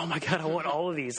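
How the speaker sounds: retelling a thrilled reaction, voice lifted; excited. Retold or imitated. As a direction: gush like an eager fan. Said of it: "I was getting so excited".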